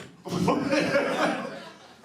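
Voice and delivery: high breathless laughter; airy high cackle; wheezing laugh. Real, not acted. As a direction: crack up at the memory. Laughing at own story.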